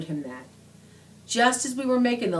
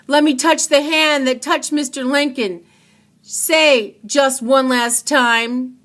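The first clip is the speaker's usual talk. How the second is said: higher, louder, slow desperate plea; pleading farewell; 7 semitones higher, 8 dB louder than usual. A narrator's reading. Pink being separated from Say, slow tearful plea. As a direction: reach for him one last time.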